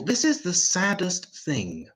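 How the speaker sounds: normal talking voice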